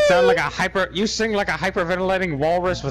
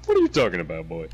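low Eddie Murphy impression; lightly voiced Eddie Murphy; 13 semitones lower, faster than usual. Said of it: "let me do the Eddie Murphy for him".

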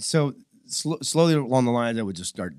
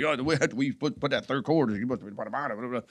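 higher-pitched, garbled fast-talker impression; Fast Eddie; 3 semitones higher than usual. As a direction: imitate a mentor rattling off instructions. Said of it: "that's how he talks".

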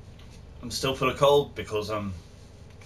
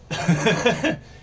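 loud high laugh; 9 semitones higher, 5 dB louder than usual. Real, not acted. Laughs at blade pose.

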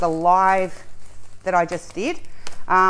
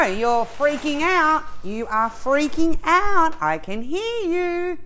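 slow, higher teasing sing-song; teasing viewers; 7 semitones higher, slower than usual. Real, not acted. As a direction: tease the audience playfully. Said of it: "I know".

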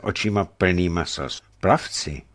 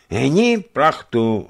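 high, drawn-out call; volání ze zádi; 6 semitones higher, 4 dB louder, much slower than usual. A narrator's reading. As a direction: call it out slowly across the deck. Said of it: "ozvalo se ze zádi". (protáhlé zvolání)

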